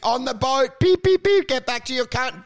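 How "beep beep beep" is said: very high, loud censor beeps; yelled censor beeps. Pretend. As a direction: shriek the bleeps out like a censor tone. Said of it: "I did the beeping for you".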